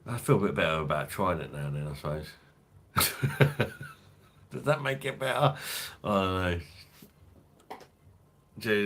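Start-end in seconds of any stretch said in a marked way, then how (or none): 2.9-3.9 laugh. Real, not acted. Laughing at his own joke.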